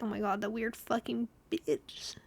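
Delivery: hushed sneering aside; mock disgusted whisper. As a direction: whisper it like a scornful guy. Imagined guy mocking her.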